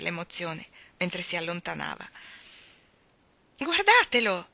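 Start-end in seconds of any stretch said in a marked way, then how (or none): 3.6-4.5 bambino che grida forte e acuto; grido di Michael. A narrator's reading. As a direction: chiama eccitato il fratello. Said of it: "gridò Michael". (Michael grida alto e forte)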